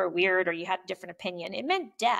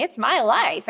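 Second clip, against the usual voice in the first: ordinary delivery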